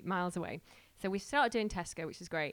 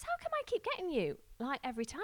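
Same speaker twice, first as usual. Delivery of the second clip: puzzled driver; 7 semitones higher than usual. Retold or imitated.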